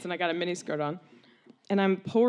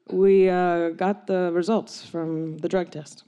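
slow, halting dad voice; grave dad; 2 semitones lower, slower than usual. Retold or imitated. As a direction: deliver the news slowly as a solemn father. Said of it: "And my dad's like".

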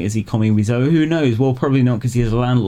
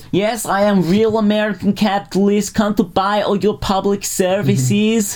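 Tommy Wiseau voice; 11 semitones higher than usual. Pretend. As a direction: play a greedy accented oligarch.

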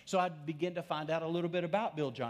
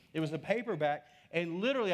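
ordinary delivery

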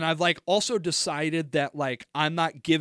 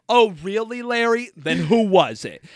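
higher, louder mock challenge; confrontational interruption; 4 semitones higher, 5 dB louder than usual. Retelling cutting the roommate off mid-excuse, confrontational. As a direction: cut in with a pointed, loud comeback. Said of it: "I cut him off".

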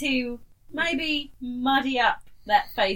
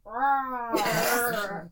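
lopsided-mouth pirate growl; slack-jawed pirate rawr; pirate grunt. Shown as a demonstration. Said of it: "and just go like".

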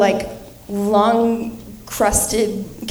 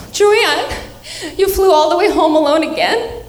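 high, plaintive grandmother voice; doting grandma; 7 semitones higher than usual. Retold or imitated. Grandma to single granddaughter home for the holidays, doting and plaintive. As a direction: imitate a grandma fretting over her single granddaughter. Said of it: "she'll just be like".

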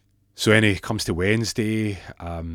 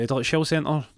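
high-pitched phone-answering voice; lilting, higher switchboard hello; receptionist greeting; 7 semitones higher than usual. Acted.

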